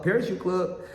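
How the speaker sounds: high-pitched mock call-out; playful taunt. Trying out a line for when he fakes somebody, playful taunt. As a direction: toss it out as a playful catchphrase.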